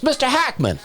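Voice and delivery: high, eager mock call of the name; dad's greeting. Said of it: "my dad opens the door and goes".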